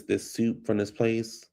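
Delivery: normal talking voice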